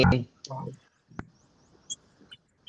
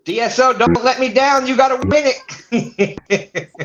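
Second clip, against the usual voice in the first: pleading fan; 8 semitones higher than usual. Retold or imitated. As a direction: beg the team not to let you down. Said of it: "Jose is saying".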